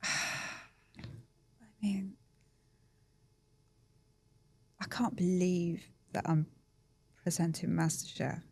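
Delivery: overcome, barely getting the words out; emotional. Real, not acted. Asked what the new job means, quiet and emotional. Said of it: "I'm quite emotional".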